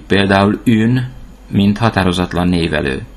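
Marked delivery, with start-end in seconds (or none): 0.6-1.1 ün with a rounded front vowel. Shown as a demonstration. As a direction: say ün like French une, lips rounded. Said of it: "U betűt általában ünek ejtjük".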